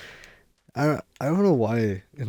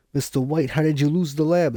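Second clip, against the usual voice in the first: bad Jesse impression; 6 semitones higher, 5 dB louder than usual. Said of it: "It sounds nothing like Jesse".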